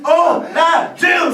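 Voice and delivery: slow, loud chant-like call; slow shout. Acted. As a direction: bellow for the retake. Director calling for another take.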